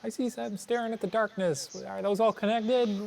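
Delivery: imitating a witness. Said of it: "some of the people looked up and said".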